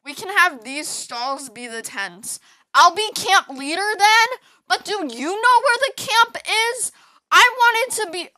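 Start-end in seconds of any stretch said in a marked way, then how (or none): 2.7-8.3 excited claim. Real, not acted. Claiming the role of camp leader.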